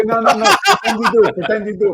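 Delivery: high, loud playful plea; playful insistence. Real, not acted. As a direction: beg him playfully, high.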